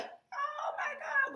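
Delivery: high, stretched-out shocked gasp; slow and very high, jolted by the gun; startled. Retold or imitated. Recruit startled by her first rifle shot, very high slow gasp. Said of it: "I'm like".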